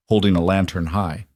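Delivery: ordinary delivery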